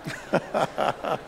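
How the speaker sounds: short breathy laugh under the voice; a quiet amused chuckle; low chuckle at his own joke; soft chuckle. Real, not acted.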